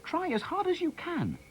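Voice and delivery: very high, pleading cry; high-pitched, insistent appeal; urging shout. A narrator's reading. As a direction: urge him on in a high boy's call. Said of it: "shouted Harry".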